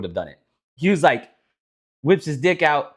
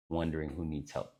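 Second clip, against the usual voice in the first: usual speaking voice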